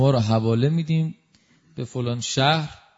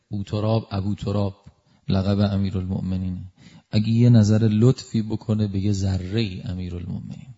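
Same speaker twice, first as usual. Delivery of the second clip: ordinary delivery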